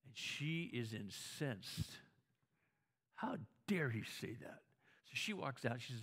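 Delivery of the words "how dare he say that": hushed, outraged whisper; indignant whisper. Retold or imitated. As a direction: hiss it under your breath in disbelief. Wife's silent outrage at husband.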